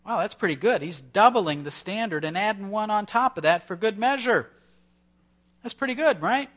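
high, loud mock praise for Peter; a hammed-up "wow, how generous"; mock impressed. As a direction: play up how generous he thinks he is.

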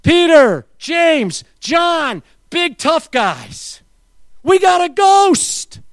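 high, loud panicked yell, stretched slowly on the last words; hammed-up panic, big men shrieking; mock terrified scream. Disciples shouting to each other in the storm, mock terrified yell. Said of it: "They were screaming so loud in terror".

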